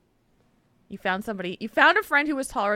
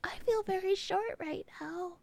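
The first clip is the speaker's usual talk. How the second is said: whisper; 7 semitones higher, 10 dB quieter than usual. Acted. Joking about feeling short.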